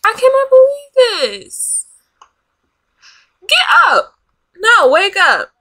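high-pitched, exasperated cry; outraged yelling. Real, not acted. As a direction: yell at her to wake up, outraged. Yelling at sleeping game character.

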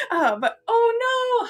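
high, loud laugh, slow and drawn out. Real, not acted. Telling viewers about bad consoling, laughing helplessly. Laughing at her own awkwardness.

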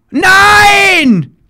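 long, high, loud wail of despair; real gamer despair; anguished shout. Real, not acted. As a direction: drag out the no as it fails. Frustrated gamer missing again.

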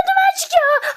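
high, loud panicked cry of duman; alarmed shout. Real, not acted. Seeing smoke rise from the pan, alarmed shout.

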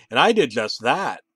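ordinary delivery, nothing marked

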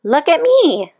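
put-on a young beetle bragging to the world; proud little beetle. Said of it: "said the young click beetle".

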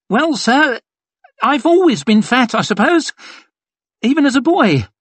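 very high, squeaky, nervous reply; high-pitched, awkward self-deprecation; flustered fat soldier. A narrator's reading. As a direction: squeak it out haltingly to a superior. Narrator voices flustered fat soldier.

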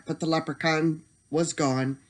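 usual speaking voice

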